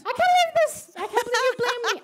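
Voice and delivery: high, loud mock outrage; mock indignation. Author accused of making the ending sadder, mock outrage. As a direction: protest playfully as if wronged.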